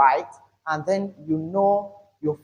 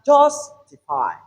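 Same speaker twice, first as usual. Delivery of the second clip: "justify" with JUS long and strong; stressed first syllable; 9 semitones higher, 4 dB louder, slower than usual. Shown as a demonstration. Teacher shows stress is loud and long, first syllable stressed. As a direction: lengthen and lean on JUS in "justify". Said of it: "a stressed syllable is not only loud".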